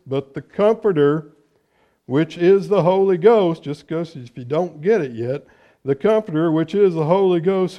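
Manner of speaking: loud, ringing preacher's reading of scripture. Acted. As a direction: proclaim the verses to the congregation. Reads scripture aloud in sermon.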